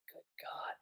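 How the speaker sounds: whispered, breath-only sigh of dismay; exasperated sigh. Acted. Hein reacting to the vow to kill, exasperated whisper. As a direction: sigh the words out under the breath.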